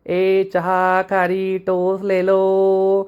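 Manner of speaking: imitated hawker's cry, every vowel stretched; droning sing-song khari seller; street vendor's call. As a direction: call out the wares slowly on one held note. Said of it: "असा जोरात ओरडायचा".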